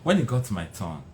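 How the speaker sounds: ordinary delivery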